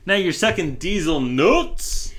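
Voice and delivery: gleeful slow, high parody; hammed-up mock echo. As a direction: draw it out loudly for the punchline. Mocking echo of the slang.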